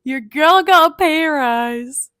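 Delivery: loud, high, drawn-out boast; proud shout of good news; excited brag. Real, not acted. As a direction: crow about the news like a victory. Bragging about her pay rise.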